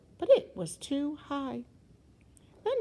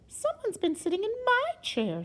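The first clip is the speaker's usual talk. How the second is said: high-pitched Mama Bear; middle-sized bear voice; 11 semitones higher than usual. A narrator's reading. Mama Bear finds her chair used, high middle-sized voice. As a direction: pitch the bear mother well up. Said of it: "said Mama Bear in her middle-sized voice".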